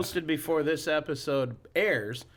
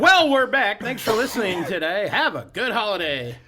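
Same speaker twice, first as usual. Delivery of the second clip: bright, louder host sign-off; podcast outro voice; 5 semitones higher, 6 dB louder than usual. Shown as a demonstration. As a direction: wrap up the show like a radio host. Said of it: "I'll do stuff like conclusion".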